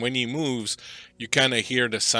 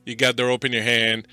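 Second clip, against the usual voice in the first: loud, raised game master narration; scene setting; 3 semitones higher, 9 dB louder than usual. Acted. Game master walks the player across the bridge, loud suspenseful narration. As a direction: count off each step in short loud phrases.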